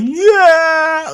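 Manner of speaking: sudden very high loud "Yeah!" yelp; pained yelp. Retold or imitated. Grabbing a thorny branch while slipping, loud yelp. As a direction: yelp as thorns bite your hand. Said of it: "I'm like".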